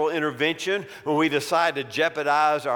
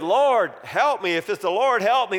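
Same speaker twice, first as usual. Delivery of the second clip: high, louder plea for help; acted desperate; 6 semitones higher, 4 dB louder than usual. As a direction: plead urgently to someone above. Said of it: "he was desperate now".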